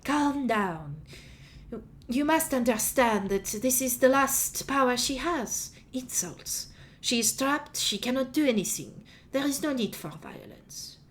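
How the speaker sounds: high, soft, slow voice of restraint; Galatea. Acted. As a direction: soothe an angry companion, slowly and softly. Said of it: "Bishop Sarah's shoulder and says".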